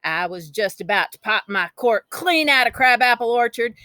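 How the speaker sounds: usual speaking voice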